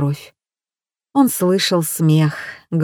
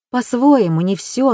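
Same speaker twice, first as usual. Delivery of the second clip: usual speaking voice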